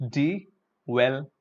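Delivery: "Develop" slow, di-vel-op; correct develop. Shown as a demonstration. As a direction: draw out "Develop" starting with di. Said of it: "look at this here".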